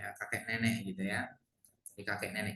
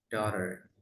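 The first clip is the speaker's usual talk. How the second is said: American "daughter" with a sounded final r; American pronunciation; 3 semitones lower than usual. Shown as a demonstration. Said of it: "Kalau American". ("daughter" logat Amerika)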